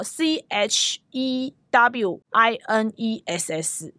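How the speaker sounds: letter by letter, slowly; spelling it out. Shown as a demonstration. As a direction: spell C H E W I N E S S one letter at a time. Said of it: "怎么拼哦".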